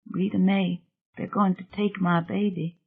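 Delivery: quiet desperate plea to Rita, hushed. Acted. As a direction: murmur it like a frightened mother. Remembered desperate plea of Deirdre.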